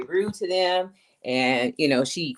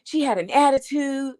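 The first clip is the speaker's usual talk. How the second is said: much higher, slow whiny mock voice; mocking a liar; 11 semitones higher, slower than usual. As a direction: imitate a lying coworker playing the victim. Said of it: "one of those liars that would be like".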